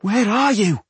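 high, urgent frightened call; panicked call. Acted. Dwarf panicked, calling for twins.

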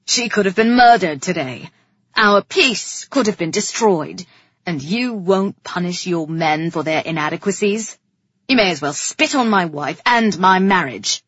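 loud, forceful reproach; angry berating. A narrator's reading. King berating his brother over the queen's danger, angry. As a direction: build a loud accusation.

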